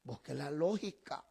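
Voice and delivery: low, slow muttered jab; muttered retort. Retold or imitated. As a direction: grumble it half under breath at a stubborn brother. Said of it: "Poquito me faltó decirle".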